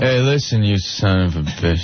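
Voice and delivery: put-on belligerent drunk. Mock drunk fight with Opie.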